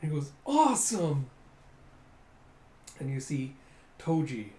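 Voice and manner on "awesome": raised, louder cheer; mock thrilled kid. The urchin after touching Toji's fist, excited. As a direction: burst out like a starstruck kid.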